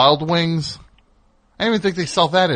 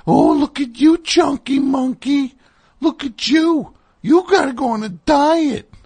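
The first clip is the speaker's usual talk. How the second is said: very high, drawn-out mock baby-talk; fun dog voice; 14 semitones higher, 4 dB louder than usual. Groomer talking to the dog about its diet, squeaky fun dog voice. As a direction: squeal it like cooing at a pet. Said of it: "the fun dog voice".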